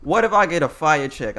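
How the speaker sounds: normal talking voice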